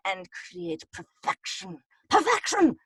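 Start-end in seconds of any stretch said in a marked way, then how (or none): 2.1-2.8 exclaimed. Pretend. Mock grand exclamation of the word.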